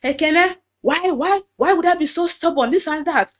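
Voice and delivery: high, loud scolding voice of the pastor; lightly voiced pastor scolding a girl. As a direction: mimic the pastor chiding her. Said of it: "He was like".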